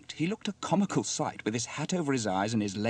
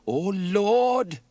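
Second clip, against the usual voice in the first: high, loud, drawn-out alarm; dismayed exclamation; 10 semitones higher, 10 dB louder than usual. Acted. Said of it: "exclaimed Trevor as he braked sharply".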